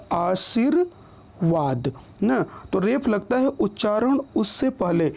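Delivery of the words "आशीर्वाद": आशीर्वाद very slow, long pause after आशीर; split pronunciation. Shown as a demonstration. Teacher showing that reph sounds first, आशीर्वाद slowly split. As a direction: break आशीर्वाद as आशीर, then वाद. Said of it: "उच्चारण क्या हुआ".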